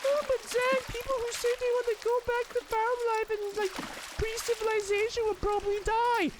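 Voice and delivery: high, squeaky mocking whine; high sarcastic imitation of a critic.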